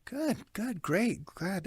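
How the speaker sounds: high, hollow quick approval; lightly voiced scripted caller; telemarketer voice. Shown as a demonstration. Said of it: "you sound like a telemarketer".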